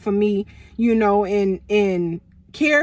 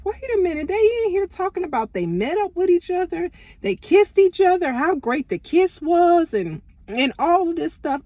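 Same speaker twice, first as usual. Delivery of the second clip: higher, rising indignant disbelief; retold disbelief; 7 semitones higher than usual. Retelling finding the texts, high incredulous outrage. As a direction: replay the moment it sank in. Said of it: "I'm like".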